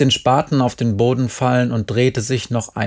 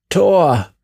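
louder, higher exclamation; a raised, falling cry; called out; 6 semitones higher, 5 dB louder than usual. A narrator's reading. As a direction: call it out sharply on one word. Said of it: "rief Jerry".

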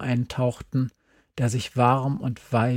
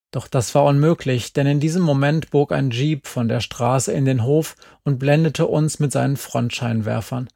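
ordinary talk

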